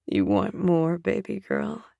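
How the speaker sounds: deep, teasing lover voice; low teasing man. A narrator's reading. Male lover's teasing line.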